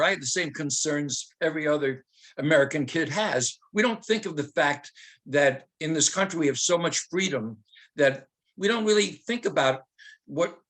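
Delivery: normal talking voice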